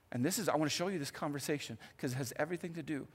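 ordinary talk, nothing marked

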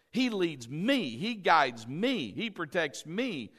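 slow, each ME landed separately; three measured phrases, each leaning on "me"; stress on ME. Shown as a demonstration. Preacher claiming the shepherd as his own, stressing each ME. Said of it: "but he's mine".

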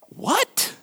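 sharp high astonished what; a high, baffled what; incredulous what. Acted. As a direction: react as if it makes no sense. Preacher reacts to Jesus' words.

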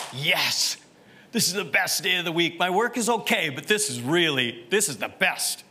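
eager, quickening cheer for the day; acted enthusiasm. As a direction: play the thrilled churchgoer, pitch jumping up midway. Acting out churchgoers' enthusiasm for Sunday.

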